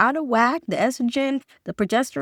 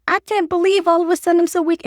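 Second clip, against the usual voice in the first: mock complaint; 7 semitones higher, 3 dB louder, faster than usual. Quotes women's complaint.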